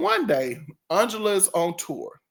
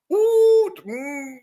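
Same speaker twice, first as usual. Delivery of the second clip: mock ooh; 12 semitones higher, 6 dB louder than usual. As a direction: tease with a knowing ooh. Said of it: "she was like".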